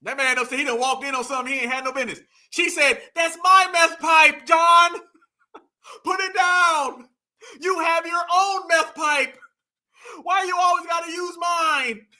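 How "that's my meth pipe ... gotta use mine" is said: high, slow, hammed-up scolding; mock mad woman. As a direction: wail in drawn-out mock outrage. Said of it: "She mad right there".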